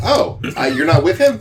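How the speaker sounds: high, loud surprised question; taken aback. Acted. Game master voices surprised merchant.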